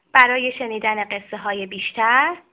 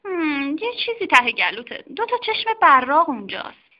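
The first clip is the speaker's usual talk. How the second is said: higher-pitched curious lady doctor; a brisk doctor examining a patient; doctor's voice; 4 semitones higher than usual. Acted. Lady doctor examining the strange dog, curious and higher. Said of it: "خانم دکتر ... گفت".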